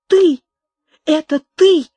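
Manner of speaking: recognising her betrayer, she cries out in horror; horrified cry. Acted. Amaranta recognises Carlos, a horrified shriek. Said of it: "вскричала она с ужасом".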